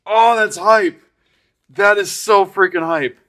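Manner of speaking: high, loud, thrilled exclamation; hyped. Real, not acted. Streamer thrilled by the new card, hyped and high. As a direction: let the hype lift your voice high.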